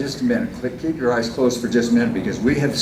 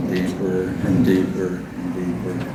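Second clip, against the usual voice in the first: hypnotized dreamy voice; 4 semitones lower, 8 dB quieter than usual. Acted. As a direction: lull them down into sleep. Hypnotist induction patter.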